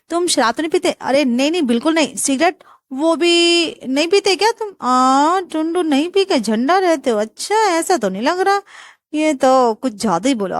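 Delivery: put-on aunt pressing the boy about his habits; nosy aunt. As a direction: probe him suspiciously. Aunt interrogating groom.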